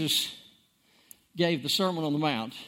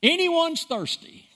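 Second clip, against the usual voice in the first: much higher, slow, ringing call; cried out; 11 semitones higher, slower than usual. Retold or imitated. Preacher voicing Jesus crying out to the crowd, high ringing call. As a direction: cry it out across the crowd. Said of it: "Jesus stood and cried out".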